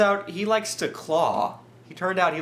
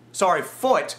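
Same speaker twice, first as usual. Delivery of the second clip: ordinary talk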